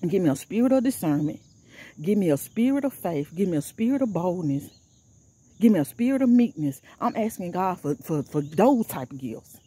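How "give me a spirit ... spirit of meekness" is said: earnest spoken prayer, steady and pleading. Real, not acted. Praying aloud to God for spiritual gifts, earnest prayer. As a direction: pray it out to God, line by line.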